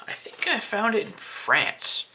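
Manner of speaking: hushed confiding aside; whispered aside. Real, not acted. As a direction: whisper it as a secret.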